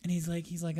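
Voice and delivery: normal talking voice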